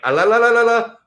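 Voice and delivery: high, loud la-la-la babble; mock refusal to listen. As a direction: drown them out with childish la-las. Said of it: "they don't want to, they're like".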